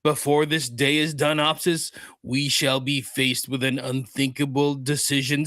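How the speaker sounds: put-on lightly accented, portentous proclamation; a restrained go at an East German accent; mild villain accent. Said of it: "he's got a East German accent".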